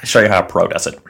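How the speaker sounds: ordinary talk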